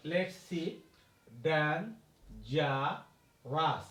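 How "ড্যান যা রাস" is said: ড্যান, যা, রাস as three separate beats; syllable-by-syllable ড্যান - যা - রাস; split into three syllables. Shown as a demonstration. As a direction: pause after ড্যান and after যা, ending on রাস. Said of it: "আমরা তিনবারে উচ্চারণ করেছি".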